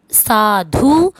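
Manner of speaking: very slow, stretched-out syllables; drawn out. Shown as a demonstration. Teacher reciting word forms to pupils, drawn out. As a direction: draw the word Sadhu right out.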